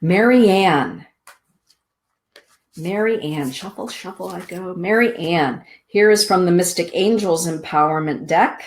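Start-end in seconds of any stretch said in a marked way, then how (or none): 3.5-4.8 much softer aside while shuffling; murmured to herself. Real, not acted. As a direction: mutter it under your breath.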